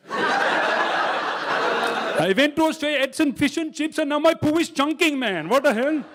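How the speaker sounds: high, hammed-up Indian accent; outraged Indian tourist, exaggerated. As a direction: complain loudly in a mock Indian accent. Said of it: "do you think Indian people come here".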